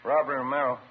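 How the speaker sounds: loud phone answer. Acted.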